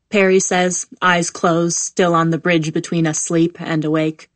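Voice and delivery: ordinary delivery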